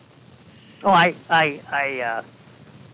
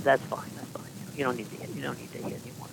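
hushed; 9 dB quieter, faster than usual. Retold or imitated. Coach calms kids before game.

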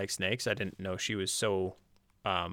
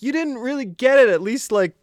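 loud, high, exasperated plea; exasperated urging; 12 semitones higher, 9 dB louder than usual. Retold or imitated. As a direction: urge her loudly to finish it. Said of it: "And then I'm like".

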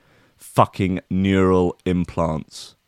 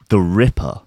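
ordinary talk

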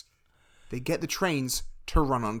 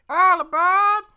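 much higher, louder, slow train conductor call; Tom Hanks conductor; 7 dB louder, slower than usual. Acted. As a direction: bellow like the Polar Express conductor. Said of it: "How's my Tom Hanks impression?".

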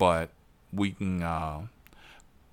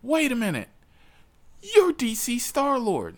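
high, rising fan excitement, louder at the end; a passenger realizing who his driver is, starstruck and pitched way up; excited fan; 14 semitones higher, 3 dB louder than usual. Retold or imitated. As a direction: starstruck squeal. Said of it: "He's like".

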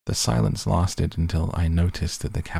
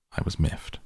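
ordinary delivery

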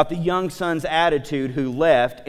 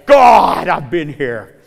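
self-pitying complaint; 8 dB louder, much slower than usual. Retold or imitated. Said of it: "you ever felt that way".

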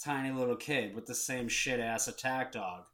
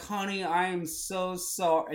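apologetic; 7 semitones higher, 4 dB louder than usual. Retold or imitated. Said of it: "they're like".

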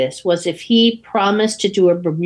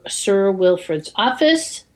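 ordinary delivery

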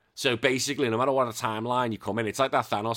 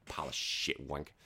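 muttered insult; 9 semitones lower, 12 dB quieter than usual. Real, not acted.

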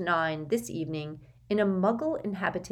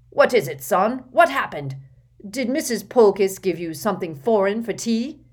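gruff Uncle Vernon; 3 semitones higher, 7 dB louder than usual. Acted. Vernon questions his son loudly.

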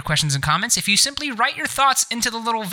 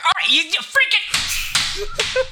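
frustrated strain; slower than usual. Real, not acted. Struggling to switch bot off.

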